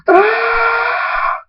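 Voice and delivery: very high, sustained shriek; long villain's death scream; drawn-out high "ah"; defeated scream. Acted.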